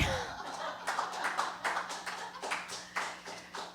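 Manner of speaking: high unvoiced giggle; helpless breathy giggle. Real, not acted. Embarrassed drunk giggle.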